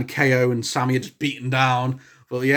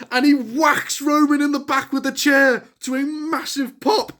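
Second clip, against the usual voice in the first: rising excitement; 10 semitones higher than usual. Real, not acted. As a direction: pitch climbs high as he tells it. Reliving the climax excitedly.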